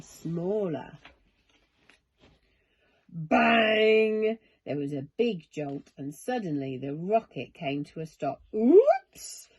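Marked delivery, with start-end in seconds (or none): 3.1-4.4 loud sudden bang. A narrator's reading. Narrator voicing the rocket's crash landing, loud bang. 8.6-9.5 high, loud yelp; shouted oops. A narrator's reading. Charlie falling in the rocket, high loud oops. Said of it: "shouted Charlie".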